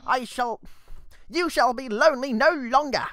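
put-on a light British accent on the skeleton's voice; British-tinged Papyrus. Papyrus pities the lonely human in a mild British accent. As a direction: let a mild British accent creep into the boast. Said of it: "It's British now".

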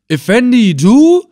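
very high, startled shout of recognition; loud cry. A narrator's reading. Old captive spotting the Effendi, loudly amazed. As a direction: shout it, leaping up in astonishment. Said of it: "rief er laut".

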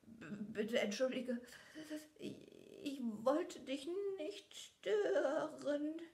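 high, slow, stuttering plea; stammering fear. A narrator's reading. Father begs the Beast for forgiveness, high and stammering. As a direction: stammer out the apology, terrified. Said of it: "stotterte er".

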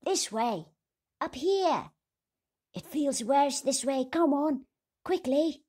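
high, loud calls up a stairwell; calling out. Acted. The little men calling up the tower stairs, loud and high. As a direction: shout to the others from below.

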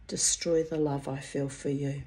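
usual speaking voice

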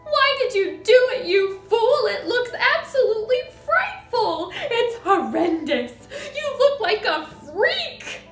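high, loud horrified tirade; shrieking. A narrator's reading. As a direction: berate your husband at full shriek. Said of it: "shrieked the mother".

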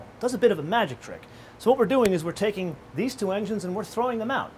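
ordinary delivery

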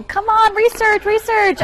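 high-pitched, insistent chiding; playfully exasperated nagging; mock exasperation. Host mock-scolds movie's mispronunciation.

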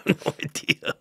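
half-laughed, voiceless admission; amused cluelessness. Retold or imitated. Son confesses he cannot explain his own phrase, laughing. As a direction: let it out through a laugh.

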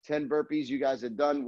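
punchy combo calls between pauses; boxing coach calling combos. Acted. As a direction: call each combo crisply, like a coach. Live combo calling in class.

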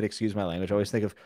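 ordinary talk, nothing marked

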